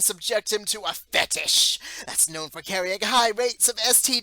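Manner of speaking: heated loud rant. Real, not acted. Commentator ranting to viewers, loud and outraged. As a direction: hammer it home with rising fury. Escalating rant about the show.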